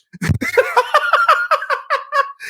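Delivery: high, cackling laugh at chat; real laughter. Real, not acted. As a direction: burst out laughing.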